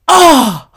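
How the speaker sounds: loud high "ah!" of being struck; moved gasp-cry. Retold or imitated. Reacting to the girl's quote, loud high cry. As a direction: cry out as if hit by it. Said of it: "I felt it".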